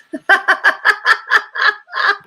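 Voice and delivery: long high burst of laughter; amused high cackle. Real, not acted. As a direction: crack up at the comment. Laughs at commenter's wording.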